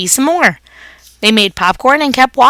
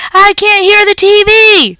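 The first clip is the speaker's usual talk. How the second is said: much higher, loud complaint; hollering kid; 11 semitones higher, 7 dB louder than usual. A narrator's reading. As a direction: holler over the noise. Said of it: "Ty hollered".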